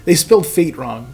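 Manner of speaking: normal talking voice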